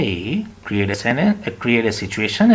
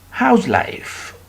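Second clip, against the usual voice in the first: "How's life?" higher, mouth opened wide; teacher modelling an open-mouthed "How's life?"; 9 semitones higher, much slower than usual. Shown as a demonstration. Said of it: "Open your mouth".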